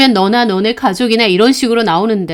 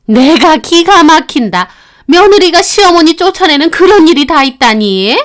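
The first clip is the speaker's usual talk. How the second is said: louder, higher, aghast at her daughter-in-law; outraged mother; 5 semitones higher, 5 dB louder than usual. Acted. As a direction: rant to her son in disbelief. Mother-in-law complaining to son. (기가 막혀 하는 시어머니)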